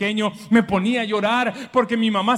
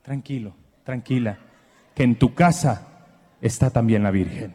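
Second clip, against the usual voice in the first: reassuring neighbor; 13 semitones lower than usual. Shown as a demonstration. Congregation told to reassure their neighbor, gentle low voice. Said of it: "Dígale al de lado".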